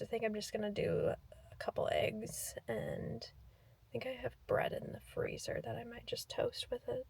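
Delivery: hushed whisper. Real, not acted. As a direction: say it under your breath. Quiet morning talk at home.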